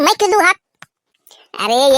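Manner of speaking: normal talking voice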